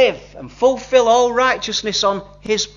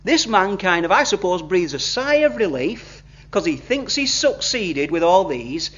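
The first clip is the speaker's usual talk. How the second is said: usual speaking voice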